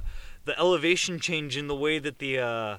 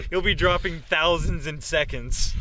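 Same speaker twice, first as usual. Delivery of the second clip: ordinary delivery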